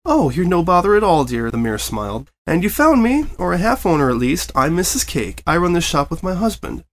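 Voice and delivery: a cheerful baker greeting a guest; kindly old mare. Acted. Kindly mare voiced by narrator.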